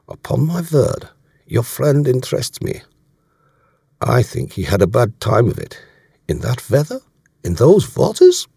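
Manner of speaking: put-on German accent, pitch climbing on the questions; German commander, amused. Voicing German commander in dialogue.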